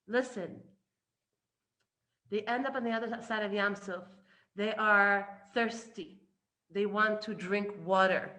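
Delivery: ordinary talk, nothing marked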